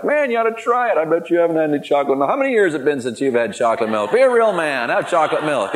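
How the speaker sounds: joking peer pressure back at partygoers; playful teasing. Retold or imitated. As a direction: dare them to be a real man, with a grin.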